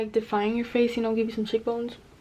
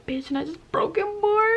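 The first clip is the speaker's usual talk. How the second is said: high, exasperated outburst; exasperated squeal; 9 semitones higher than usual. Real, not acted. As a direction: shriek it in annoyed disbelief. Bronzer breaks while talking.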